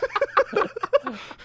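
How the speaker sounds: a sudden high laugh; high burst of laughter. Real, not acted. Laughing at the beard-at-twelve joke, high burst of laughter.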